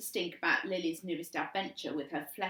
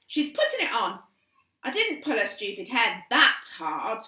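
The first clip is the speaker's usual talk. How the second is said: protesting; 4 semitones higher, 10 dB louder than usual. A narrator's reading. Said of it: "Chloe protested".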